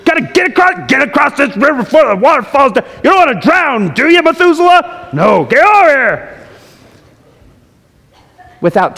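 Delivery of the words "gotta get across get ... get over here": high, loud mock panic; frantic rushing. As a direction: hustle everyone over the river. Imitating himself rushing the crossing.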